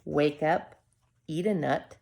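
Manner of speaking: ordinary delivery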